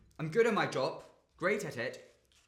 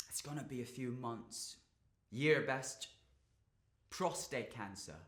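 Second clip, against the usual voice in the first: quiet, sombre admission; low-key and heavy-hearted; subdued disclosure; 3 semitones lower, 6 dB quieter than usual. Acted. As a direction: break bad news softly. Reveals father's terminal illness.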